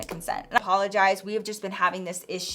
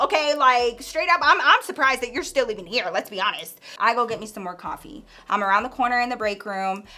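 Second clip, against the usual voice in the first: usual speaking voice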